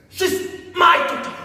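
high, loud outcry; fierce claim. Acted. Telling Themba she's my daughter, fiercely defiant. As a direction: cry it out possessively, hitting MY.

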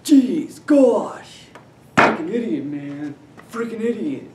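high, exasperated outburst easing off; loud whiny huff, then sinking back; Napoleon Dynamite. Acted. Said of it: "Okay, Napoleon Dynamite".

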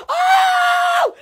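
high excited yelp; excited high cry. Real, not acted. Vlogger squealing at her own excitement, high yelp. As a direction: squeal it out in delight. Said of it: "Because I get so excited".

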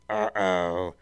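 lightly voiced Teletubby.

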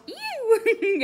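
high, loud playful ew; hammed-up yuck; mock disgust. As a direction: squeal in fake disgust. Reacting to kiss.

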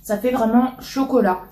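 chewing while talking; speaking around chocolate; mouth full. Real, not acted. Seen 1: bit chocolate at -3s; mouth looks full and moving during words.

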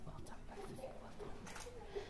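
hushed whispered praise; whispering. Real, not acted. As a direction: whisper it quietly aside. Officiant's aside during ceremony.

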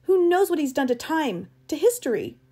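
high, loud, agitated tirade; angry rant. Acted. Travis raging that Eccles left the path, angry rant. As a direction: rail in outrage, pitch and volume up. Furious guide rants about Eccles.

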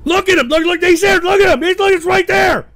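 high, loud, frantic pointing-out; outraged high-pitched outburst; agitated shouting. Real, not acted. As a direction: yell repeatedly to make them look. Spots his tormentor in chat.

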